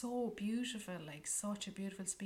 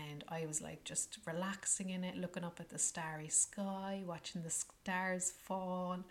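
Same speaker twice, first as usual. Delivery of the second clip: ordinary delivery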